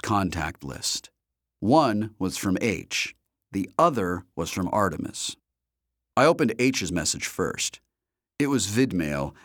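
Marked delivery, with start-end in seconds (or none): none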